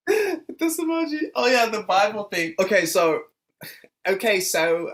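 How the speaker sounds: put-on high, loud laughing Australian accent. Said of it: "That was the best Australian accent I've ever done".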